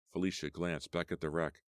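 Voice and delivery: plain reading voice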